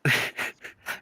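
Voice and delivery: quiet, stifled wheeze of laughter; wheezing laugh. Real, not acted. Listener stifling laughter during a film retelling, wheezing. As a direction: laugh breathlessly while trying to hold it in. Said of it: "who's wheezing in the background?".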